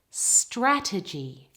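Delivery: slow "Strategy", all the weight on STRA; stress on first syllable. Shown as a demonstration. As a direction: model "Strategy" carefully, front-stressed. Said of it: "all of the stress on the first syllable".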